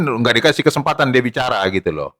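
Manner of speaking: ordinary talk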